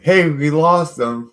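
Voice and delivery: shouted high and loud, acting the rescuers; hammed-up rescuer's shout; mock shout. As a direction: yell it out like a rescuer who just lost the sled.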